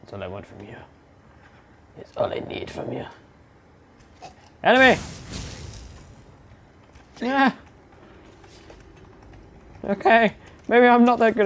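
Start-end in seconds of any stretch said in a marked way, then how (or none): 4.6-11.5 high, loud panicked lines; alarmed shouting. Real, not acted. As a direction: yell as the enemy overwhelms you. Losing fight, alarmed.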